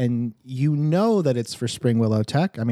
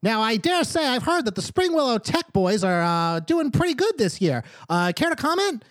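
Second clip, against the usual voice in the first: put-on eager kid reporter; 9 semitones higher than usual. Gnome boy reporter speaks.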